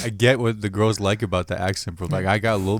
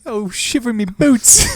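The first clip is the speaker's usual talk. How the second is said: hammed-up posh British exclamation, higher and slow; mock British accent; 11 semitones higher, 5 dB louder, slower than usual. Friends joking in fake British accents, hammy swoon. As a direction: camp it up like a swooning Brit. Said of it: "British accent".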